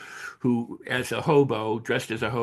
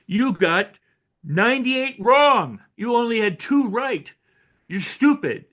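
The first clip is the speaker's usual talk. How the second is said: higher, louder, scornful teacher voice; harsh teacher scolding; 7 semitones higher, 5 dB louder, slower than usual. Retold or imitated. A teacher belittling a failing child, mock harsh and scornful. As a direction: sneer at the pupil's two right answers.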